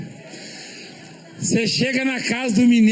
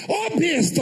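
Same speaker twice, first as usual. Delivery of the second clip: Paraíba greeting; 8 semitones higher, slower than usual. Retold or imitated. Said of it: "cheguei lá na Paraíba".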